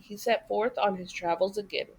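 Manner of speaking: ordinary delivery, nothing marked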